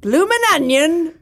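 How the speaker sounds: Australian attempt that lands very Cockney; high, slow, hammed-up Cockney-sounding Aussie; failed Australian accent. Shown as a demonstration. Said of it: "that sounded very Cockney".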